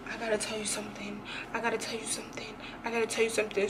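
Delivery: fast, hushed "I gotta tell you something"; urgent whisper. Retold or imitated. Telling her cousin a secret, in a fast urgent whisper.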